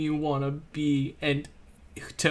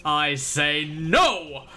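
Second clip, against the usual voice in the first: high, drawn-out bombastic oratory; ranting politician; 5 semitones higher than usual. Acted. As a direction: build to a grandiose rallying cry.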